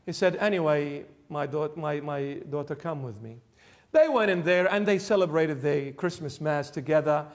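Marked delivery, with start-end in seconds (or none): 0.4-3.4 tender invitation. Retold or imitated. Priest comforting lonely widow.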